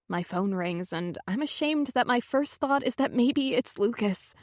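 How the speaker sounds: ordinary delivery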